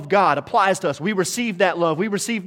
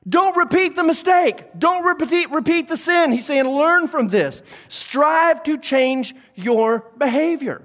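raised, high-pitched preacher's exhortation; emphatic preaching; 10 semitones higher, 5 dB louder, slower than usual. Acted. Preacher urging people not to sin again, emphatic preaching. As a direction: preach it forcefully, well above your talking pitch.

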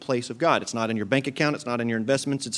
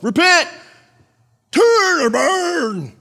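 high, loud mock sidewalk-evangelist yell; screaming street preacher; 8 dB louder, much slower than usual. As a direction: scream it like a man with a megaphone, dragging out the last words. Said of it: "he's screaming and yelling".

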